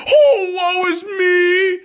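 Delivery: high, slow, mock-crying whine; whiny victim voice. Podcaster mocks a whiny victim, high slow mock sobbing. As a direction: whimper like a self-pitying crybaby. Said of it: "that asshole with that victim mentality saying".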